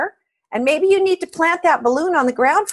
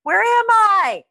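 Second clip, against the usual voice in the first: yelling down; 11 semitones higher, much slower than usual. Retold or imitated. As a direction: shout it down to the stranger below. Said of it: "he yells down".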